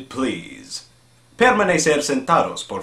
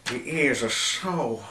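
dreamy stoner voice; 5 semitones higher than usual. Retold or imitated. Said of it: "voice impressions".

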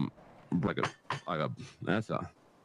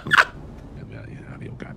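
laughing; 6 semitones lower, 5 dB louder than usual. Real, not acted. Laughing through the line.

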